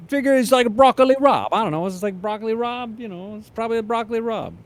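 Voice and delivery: high, loud Brooklyn deli guy; shrugging New York counterman; Brooklyn accent. Retold or imitated.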